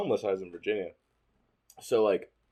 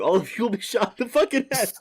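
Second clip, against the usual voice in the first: mock threat yell; 10 semitones higher, 3 dB louder than usual.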